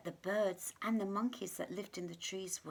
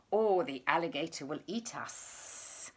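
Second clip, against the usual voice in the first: hissing snake; 3 semitones lower than usual. Acted. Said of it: "hissed the snake".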